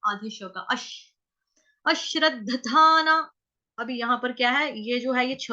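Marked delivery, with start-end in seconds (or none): 1.9-3.3 अश्रद्धधाना slowly, leaning into the doubled sh after अ; higher, drawn-out अश्रद्धधाना with long aa; stressed syllable demo. Shown as a demonstration. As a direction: chant अश्रद्धधाना slowly, weight on the opening. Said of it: "अ के ऊपर है आघात".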